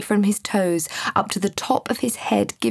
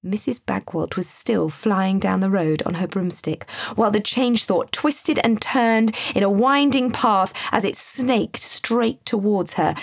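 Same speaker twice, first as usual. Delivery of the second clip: ordinary delivery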